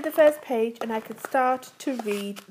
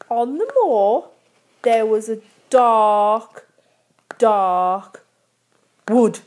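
spooky story reading; 2 semitones lower, 4 dB louder, slower than usual. Acted.

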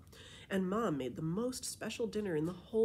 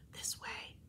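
voiceless, softer whisper; 8 dB quieter than usual. Acted. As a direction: lead them on in a hush. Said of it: "I whispered to everyone".

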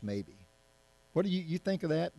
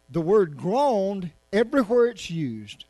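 "groaned" drawn out slowly, louder and higher; stress on groaned; 6 semitones higher, 8 dB louder than usual. Real, not acted. Preacher telling the congregation what "groaned" means, slow and stressed. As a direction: lean hard on "groaned", slow it right down. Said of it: "preacher stressing key word".

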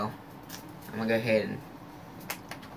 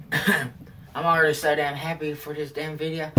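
ordinary delivery

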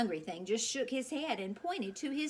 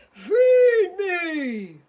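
much louder booming beast roar; roaring monster demand; 10 semitones higher, 15 dB louder than usual. Acted. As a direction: bellow it like a ravenous monster. Hungry monster points to sign.

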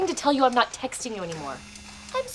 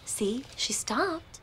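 soft slow whisper; 8 dB quieter, much slower than usual. Acted. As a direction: whisper it secretly. Whispered aside.

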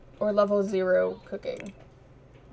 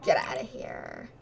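whisper; 3 dB quieter than usual. Retold or imitated. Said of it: "It's like".

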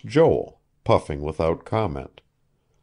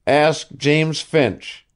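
curt, slow, higher dismissal; 8 semitones higher, 4 dB louder, slower than usual. A narrator's reading. Asa dodging Joel's question, curt. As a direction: shut the question down. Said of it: "he said curtly".

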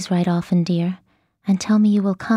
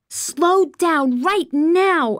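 high, drawn-out cry; mock scream, pitched up and slow; screamed order; 7 semitones higher, much slower than usual. A narrator's reading. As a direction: stretch each word in a high, urgent yell. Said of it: "I screamed".

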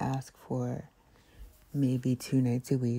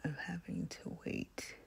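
whisper; 10 dB quieter than usual. Real, not acted. ASMR whisper into the ear.

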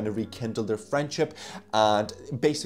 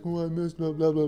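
higher-pitched, half-hearted fake sobbing; mock crying; 5 semitones higher than usual. Reviewer mimics amateur actor crying on the phone, mock tearful. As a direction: badly act tearful on the phone. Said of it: "he's crying on the phone".

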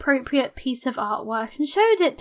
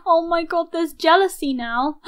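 normal talking voice

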